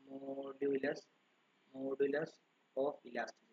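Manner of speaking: slow, fading, word-by-word definition with repeats; slow dictation. Shown as a demonstration. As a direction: dictate it so every student keeps up. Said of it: "dictating a definition to students". (നിർവചനം പതുക്കെ എഴുതാൻ പറഞ്ഞുകൊടുക്കുന്നു)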